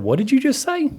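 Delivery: high, indignant disbelief; retold disbelief. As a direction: shoot the pitch up in shock. Retold outraged reply to driver.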